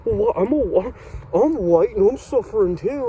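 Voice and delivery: high, loud, hammed-up whine with stumbles. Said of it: "be all pissy and be like".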